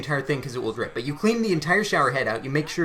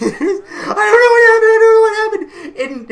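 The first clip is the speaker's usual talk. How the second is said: hysterical crying wail, much higher and louder; sister freaking out; 11 dB louder than usual. Retold or imitated. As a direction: imitate a terrified sister sobbing out the words. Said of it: "My sister is like".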